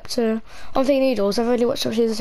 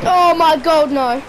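louder, higher burst of fright; startled; 5 semitones higher, 6 dB louder, slower than usual. Real, not acted. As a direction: jolt with sudden fear. Sudden fright in game.

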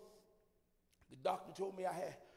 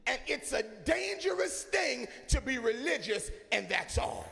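loud, fervent sermon delivery; whooping preacher building to a cry; full-force preaching; 3 semitones higher, 7 dB louder, slower than usual. Real, not acted. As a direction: preach it hard over the organ.

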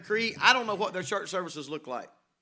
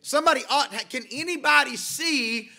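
rising preaching fervor; 4 semitones higher, 3 dB louder than usual. Acted. Preaching climax, building intensity.

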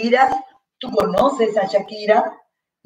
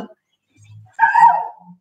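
very high mock "Sao"; comic squealed mispronunciation. Teacher joking about how students say saw, high squealed "Sao". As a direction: squeal "Sao" far above the usual voice.

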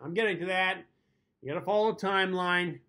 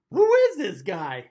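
mock outrage; 4 semitones higher, 8 dB louder, slower than usual.

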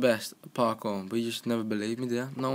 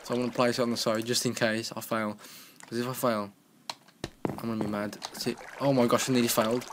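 ordinary talk

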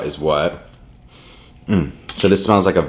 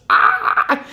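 high breathless squeal of delight; excited squeal; 3 dB louder than usual. Real, not acted. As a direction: shriek at the sight of the food. Food reveal freak-out.

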